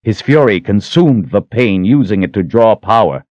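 plain reading voice

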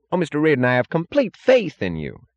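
high-pitched, fervent reassurance; earnest official. A narrator's reading.

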